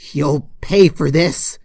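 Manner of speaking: slow, loud shaken-fist threat; angry threat. Acted. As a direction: spit the threat out slowly and loudly. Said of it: "she shouted to the heavens".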